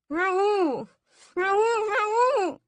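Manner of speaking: frantic high, loud cries through a gag; muffled by a gag. A narrator's reading. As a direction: yell the name repeatedly past a gag. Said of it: "the gag muffling all my words".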